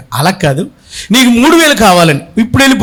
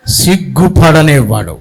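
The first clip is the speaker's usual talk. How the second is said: drawn out and lower, reading scripture; slow, low reading; 4 semitones lower, slower than usual. Shown as a demonstration. Preacher reading verse slowly to congregation, drawn out low. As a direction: let the word sink slowly. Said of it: "ఏం చేస్తాడు ఆయన?".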